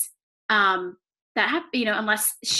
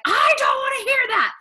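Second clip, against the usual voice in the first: high, loud frustrated yell; mock angry shout; 13 semitones higher, 5 dB louder than usual. Sister snapping at Connie over the phone, high frustrated shout. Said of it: "And she's like".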